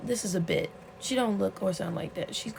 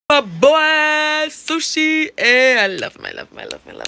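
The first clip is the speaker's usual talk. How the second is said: high, loud gushing over him; fangirling squeal; 8 semitones higher, 12 dB louder than usual. Real, not acted. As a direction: squeal with real delight. Gushing over favourite character.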